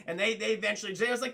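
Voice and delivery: ordinary talk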